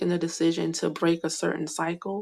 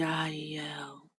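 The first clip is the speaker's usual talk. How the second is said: whispering; 9 dB quieter than usual. Real, not acted. Whispering letters of the name.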